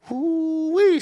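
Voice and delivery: high excited squeal; high whooping ooh-wee. Retold or imitated. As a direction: squeal with delight. Recalling a wild night.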